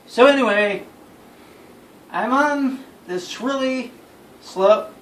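ordinary delivery

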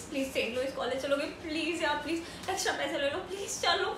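low, soft, desperate begging; pleading. Acted. Late student offering extra money, soft pleading. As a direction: coax the driver humbly. Begs driver to take her.

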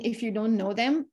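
ordinary talk

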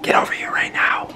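angry parent whisper yelling. Pretend. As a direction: scold in a forceful whisper. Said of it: "whisper yell".

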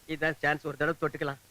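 very low, hushed voice; sly aside. Acted. Plotting to touch her hand, muttered aside.

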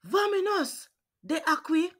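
high yelled escape call. Acted. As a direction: yell it high in alarm. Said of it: "I yelled".

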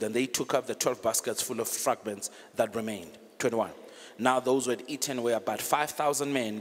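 steady, measured Bible reading; reading the Bible passage aloud; scripture reading. Acted. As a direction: read the passage out evenly to the congregation. Reads Matthew fourteen aloud.

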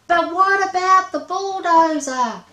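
high loud wail. Acted. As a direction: plead in despair. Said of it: "wailed the ring-tailed monster".

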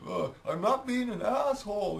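high-pitched put-on voice; imitated neighbour. Neighbour explaining himself to Steve, high imitated calling voice. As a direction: mimic him calling over, pitched right up. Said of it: "And he says".

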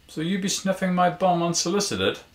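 high-pitched, loud mock indignation; po-faced challenge. A narrator's reading. Woman challenging a dog walker, high po-faced mock indignation. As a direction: ask it high and loud, deadpan in intent. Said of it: "Looking at him poo-faced".

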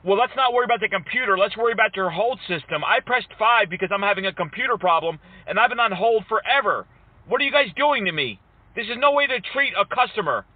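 mock-frustrated customer pleading; aggrieved customer. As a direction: whine about being kept waiting. Prankster complains as an aggrieved customer.

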